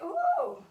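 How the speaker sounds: sudden high-pitched ooh of muscle burn; pained "ooh". Real, not acted. Instructor extending leg, hurts already, high pained ooh. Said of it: "That hurts already".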